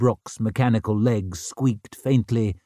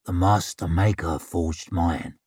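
low, broken whispery voice; broken whisper; 5 semitones lower than usual. A narrator's reading. Cool Shivers answering talk of sharpened swords, broken whisper. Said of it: "in that broken whisper of his".

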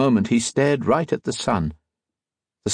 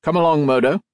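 louder, higher call across the yard; 7 semitones higher, 4 dB louder, faster than usual. A narrator's reading. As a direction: call him over from the carriage. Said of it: "Mister Socrates called".

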